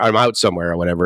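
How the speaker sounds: ordinary delivery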